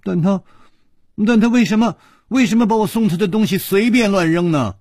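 very high, loud distressed complaint; crying princess, acted and high-pitched; tearful wailing. A narrator's reading. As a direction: wail it in hurt protest. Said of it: "琵琶公主痛哭地说".

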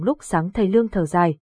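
plain reading voice

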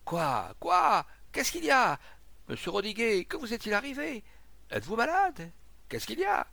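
high, alarmed shouted questions; urgent, high-pitched crowd shouts; crowd crying out. Acted. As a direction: call out like startled neighbours. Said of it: "Alors des voix crièrent çà et là".

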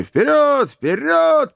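very high, exultant cheer; joyful shouting. A narrator's reading. Joe urges the elephant onward, shouting joyfully. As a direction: whoop them onward, far up high. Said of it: "продолжал радостно кричать Джо".

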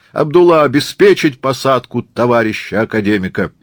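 loud command with a high first call; thunderous order. Acted. Murat orders Abdulla to board academician, shouting. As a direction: shout orders to your helper. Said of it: "громовым голосом орёт Мурат".

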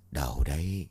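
strained, low voice of a man in pain; hurting after a kick, answering low and strained; pained groan. Acted. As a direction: squeeze it out through the pain. Man hurt after groin kick.

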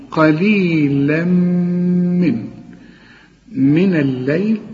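very slow, melodic chant with the merged nasal; Quran recitation. Shown as a demonstration. Teacher tells student to listen closely, then slowly recites. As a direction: draw each syllable out like in the mosque. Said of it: "اسمعها كويس".

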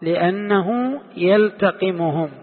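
slow, deliberate reading of the quote; teacher rereading the text slowly; slow quoted reading. Shown as a demonstration. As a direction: read the quote slowly for the class. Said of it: "قال".

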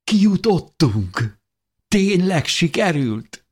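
high, slow, jubilant cry; overjoyed. A narrator's reading. Dávid greeting his friend at the bottom, jubilant. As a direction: cry it out, amazed and thrilled. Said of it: "óriási lelkesedéssel fogadott".

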